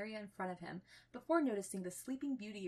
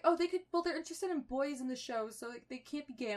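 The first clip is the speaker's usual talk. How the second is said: high, loud mocking whine; mocking whiny voice; 7 semitones higher, 6 dB louder than usual. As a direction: parody a smug know-it-all. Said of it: "everyone's like".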